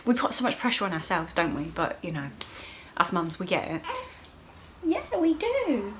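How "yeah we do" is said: high sing-song. Real, not acted. Agreeing with fellow mums.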